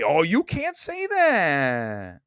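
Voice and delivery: slow, drawn-out prissy tut; mock scold. Mocking critics who scold him, slow prissy tut. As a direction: stretch it out like a pearl-clutching scold. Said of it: "but they say".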